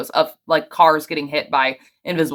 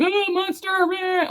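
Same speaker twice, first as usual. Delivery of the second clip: put-on high, hushed little child voice; scared kid; 3 dB quieter, slower than usual. Pretend toddler spotting a monster, high and hushed. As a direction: say it like a frightened toddler. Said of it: "he's not saying".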